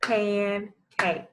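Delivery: "Pancake" as pan, pause, cake; each syllable of "Pancake" drawn out on its own; clapped syllables. Shown as a demonstration. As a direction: break "Pancake" into two separate beats. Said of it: "Clap with me this time".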